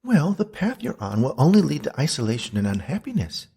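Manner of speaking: high, earnest warning; ghostly Beatrice. Acted. Ghost warns loner about isolation, high and earnest.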